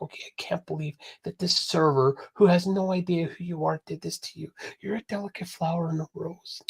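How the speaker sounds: soft, hushed mock sympathy; mock sympathetic friend. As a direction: coo it like a gushing fake friend. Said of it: "She's looking for her friends to go".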